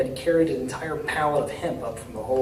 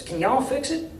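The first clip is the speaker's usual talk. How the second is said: higher, folksy Southern mayor, eager and hopeful; a down-home mayor, friendly and a bit anxious; small-town mayor; 10 semitones higher than usual. A narrator's reading. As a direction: play the hopeful country mayor asking for help. Mayor voiced in story.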